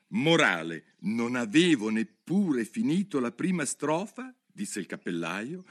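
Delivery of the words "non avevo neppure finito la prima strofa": lightly voiced hatter, rising; a hatter recounting the disaster; the mad hatter. Hatter telling Alice about the queen, lightly voiced.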